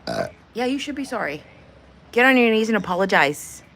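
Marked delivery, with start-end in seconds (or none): 2.1-3.6 louder commanding tone; hammed-up imperious command; mock demand. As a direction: order them to kneel.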